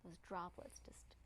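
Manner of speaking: soft, soothing relaxation voice; quiet, gentle cues with long pauses; guided meditation. Real, not acted. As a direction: ease them into letting tension go.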